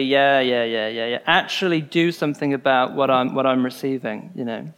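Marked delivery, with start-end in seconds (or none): 0.0-1.2 mindless yeahs. Retold or imitated. Pupil half-listening to a teacher, mindless and lower. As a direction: brush it off like a bored pupil. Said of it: "like, mindlessly".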